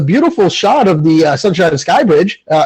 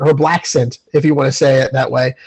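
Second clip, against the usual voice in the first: ordinary talk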